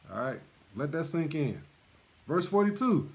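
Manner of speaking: ordinary talk, nothing marked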